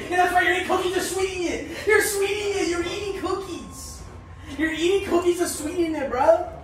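laughing teasing. Real, not acted. Teasing friend eating cookies.

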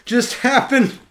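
stunned disbelief, hammed up; imitating a dumbfounded Finn after the kiss; quoting Finn. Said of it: "he's like".

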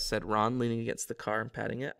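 usual speaking voice

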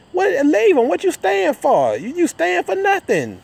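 hammed-up furious retort; mock heated yell. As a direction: yell it up high like he's fed up all over again. His own retold reply, heating up.

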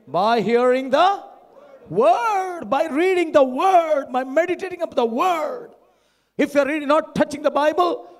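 loud, high, urgent sermon delivery; full-force preaching. Real, not acted. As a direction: press the point hard on the congregation.

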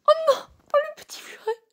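squeaky voice over a cute pet. Real, not acted. Cooing at ferret photo.

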